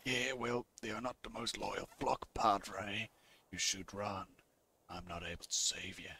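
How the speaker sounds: low, quiet gunslinger voice; understated, deep-pitched hero; laconic gunslinger. Acted. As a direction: answer very low and soft.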